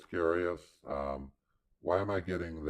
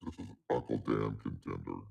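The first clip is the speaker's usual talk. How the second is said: voice-changed much lower; deep voice filter; 7 semitones lower, 3 dB quieter than usual. Shown as a demonstration. Streamer testing a deep voice changer preset, much lower. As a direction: demo a low changer preset. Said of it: "Another low one".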